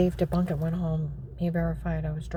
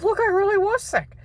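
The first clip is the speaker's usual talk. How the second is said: much higher desperate plea; pleading; 8 dB louder than usual. Retold or imitated. Speaker begging the agent, high and pleading. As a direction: plead for belief.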